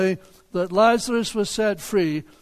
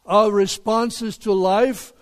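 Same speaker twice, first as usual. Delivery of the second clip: usual speaking voice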